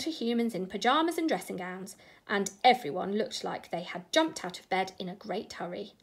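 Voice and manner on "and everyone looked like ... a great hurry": ordinary delivery, nothing marked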